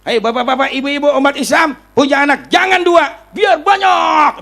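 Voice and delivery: high, loud oratorical shout; mock-rousing public address, building to a shout; re-enacted rally speech. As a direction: call out to a whole crowd, stretching the last words. Re-enacting his fiery public speech.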